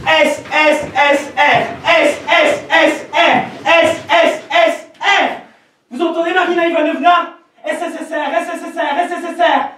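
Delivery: scandé lent et aigu comme un train. Acted. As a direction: scande les lettres comme un sifflet. Imite le train en gare. (scansion aiguë du train)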